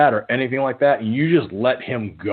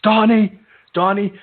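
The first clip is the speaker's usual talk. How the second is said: raised, higher mock rant; mock yelling; 6 semitones higher than usual. Tony yelling on the phone about Donnie, mock rage. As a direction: yell it like a furious caller, half-played. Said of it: "He's like".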